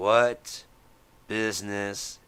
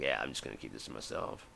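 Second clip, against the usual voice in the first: much lower and softer, quick, to himself; mock hushed aside; 9 semitones lower, 9 dB quieter, faster than usual. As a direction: mutter it low as a closeted guy would.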